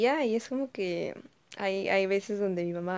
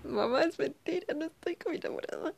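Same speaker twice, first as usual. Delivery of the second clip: hija que desmiente; 8 semitones higher than usual. Retold or imitated. Hija desmintiendo, voz distinta.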